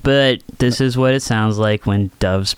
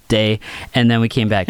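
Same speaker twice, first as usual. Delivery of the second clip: ordinary delivery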